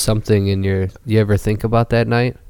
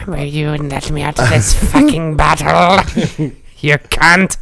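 troll voice; 5 semitones higher than usual. Pretend. S2 keeps troll voice.

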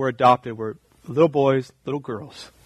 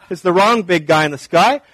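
ordinary talk

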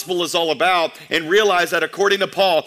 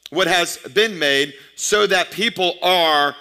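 slow emphatic reading; slower than usual. Acted.

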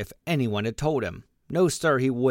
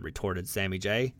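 plain reading voice

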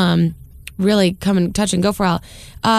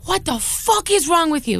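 higher-pitched mock yell, no real volume; imitated angry yelling; 7 semitones higher than usual. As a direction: act out the raging driver. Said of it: "screaming at the top of his lungs".

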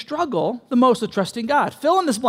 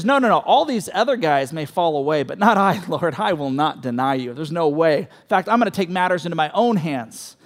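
lower, swaggering promise; a lightly voiced cocky Peter; boastful Peter; 6 semitones lower than usual. Peter boasting to Jesus he'll never fall, low and cocky. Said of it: "Peter's the guy that says".

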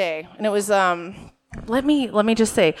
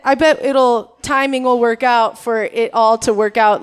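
normal talking voice

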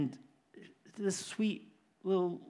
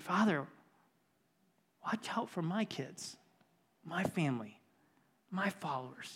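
hushed, softer plea with long pauses; quoted prayer; 7 dB quieter than usual. Retold or imitated. Jesus praying to the Father for his followers, hushed retold prayer. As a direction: pray it quietly, phrase by phrase. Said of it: "Jesus prayed".

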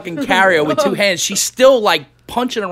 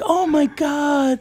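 high, slow, soppy imitation; drawn-out mock sympathy; cooing clinic staff; 9 semitones higher, 4 dB quieter, much slower than usual. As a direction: gush pityingly over a sick cat. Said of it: "all the people like".

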